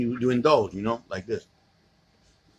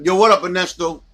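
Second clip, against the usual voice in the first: excited greeting; 6 semitones higher, 7 dB louder than usual. Real, not acted. Greets someone arriving.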